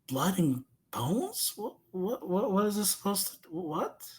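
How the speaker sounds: soft, faltering mock disbelief; quiet bewilderment. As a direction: stammer softly in stunned confusion. Child's bewildered inner reaction.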